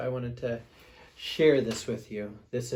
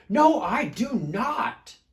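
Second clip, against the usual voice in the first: slow, loud, much higher shout; mock shout; 9 semitones higher, 8 dB louder, slower than usual. Smelly Bear shouts his refusal, mock shout. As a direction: shout it out stubbornly. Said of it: "shouted Big Smelly Bear".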